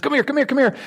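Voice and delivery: high, urgent mock call of come here; excited call. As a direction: wave a friend over, hurried and high. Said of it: "he stood up real quick and he says".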